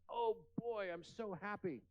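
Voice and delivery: put-on high, delighted little boy; mock squeal of a thrilled kid; excited child. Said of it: "and he screams".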